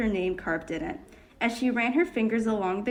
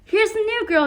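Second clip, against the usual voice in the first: high, loud proud call; booming cheerful introduction; loud announcement; 11 semitones higher, 6 dB louder than usual. A narrator's reading. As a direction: announce her to the whole room. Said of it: "he announced so loudly".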